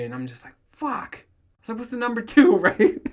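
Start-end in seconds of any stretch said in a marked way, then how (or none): 0.7-1.2 dismayed fuck. Retold or imitated. Sold-out disappointment, high exclamation. 1.8-2.6 eager question. Retold or imitated. Eager shopper asking clerk loudly.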